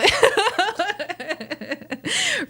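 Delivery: long, high real laugh. Real, not acted. Host amused by own raw files, laughing hard. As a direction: crack up in a high, running giggle.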